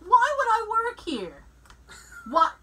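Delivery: incredulous. Retold or imitated. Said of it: "I went".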